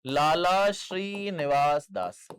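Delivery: Lala Srinivas दास stretched out word by word; slow emphatic repeat. Shown as a demonstration. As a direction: dictate Lala Srinivas दास slowly and firmly. Teacher repeats name slowly for notes. (लाला श्रीनिवास दास धीरे-धीरे, साफ़ बोलकर)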